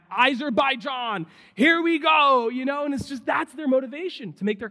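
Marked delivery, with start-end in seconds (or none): 0.1-2.5 loud, drawn-out mock battle cry; rally the country like a fired-up athlete; raised, stretched-out shout of national pride.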